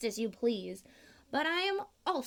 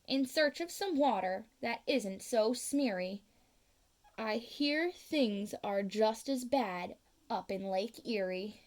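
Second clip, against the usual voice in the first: ordinary delivery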